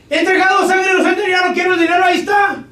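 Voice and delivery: high, loud remorseful cry; Judas pleading. Retold or imitated. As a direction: give the money back in despair. (Judas arrepentido, voz alta y angustiada)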